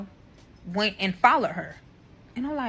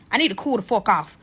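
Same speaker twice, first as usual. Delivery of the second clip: ordinary talk, nothing marked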